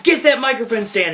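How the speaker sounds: high-pitched urgent command; put-on drill-instructor urgency; mock barked order. Acted order from roadie trainer.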